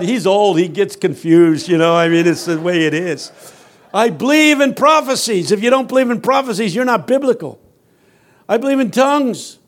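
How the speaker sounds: ordinary talk, nothing marked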